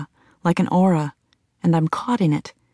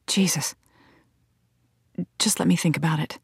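trembling; 6 dB quieter, faster than usual. A narrator's reading. Narrator unable to turn the grieving woman away, trembling. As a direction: give in softly, voice a touch unsteady. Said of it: "My voice is trembling".

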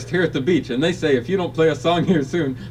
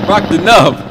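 loud hype shout; 3 semitones higher, 9 dB louder, slower than usual. Acted. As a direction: belt the catchphrase. Hype call in helicopter.

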